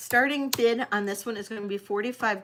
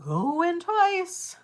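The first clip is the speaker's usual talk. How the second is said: drawn-out high sold call; auctioneer call; 6 semitones higher, much slower than usual. Acted. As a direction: auction countdown, stretched out slow. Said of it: "I'm gonna count this down for you".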